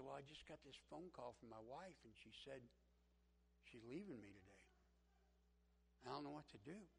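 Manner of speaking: quietly dejected. Retold or imitated. Crying boss telling his worker about his wife, quietly dejected. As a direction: say it softly, at a loss.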